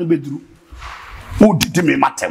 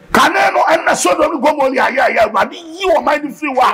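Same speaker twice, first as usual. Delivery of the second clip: raised, pushing preacher's call to the congregation; fiery preaching; 9 semitones higher than usual. Acted. Preacher rousing the church about the Holy Spirit, fiery. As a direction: preach it up with fire.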